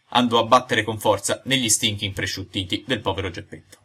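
ordinary delivery

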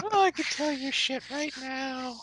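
high, slow, soft sneer; mocking high voice. Mocking a date who won't meet, high and slow. Mock caller voice.

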